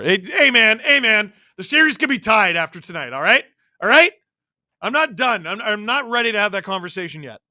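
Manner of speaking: worked-up, defensive fan objection; indignant protest. Real, not acted. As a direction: cut the doomsayer off, insistent. Pushes back on gloomy fan comment.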